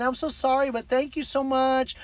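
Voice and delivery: high-pitched apologetic gushing; embarrassed woman, imitated. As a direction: mimic a flustered woman's grateful thanks. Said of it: "she's laughing, and she said".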